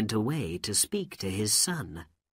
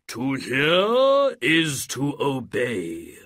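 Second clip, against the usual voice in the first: loud, slow, grand spirit voice; booming genie; 4 semitones higher, 7 dB louder, slower than usual. Acted. Genie of the lamp greeting his master, slow and booming. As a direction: boom it out like an ancient spirit.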